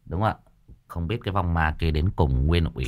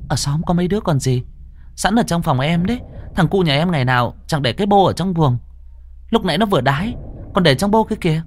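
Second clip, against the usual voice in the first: villager voice; 12 semitones higher than usual. Acted. Villager offers the child's urine.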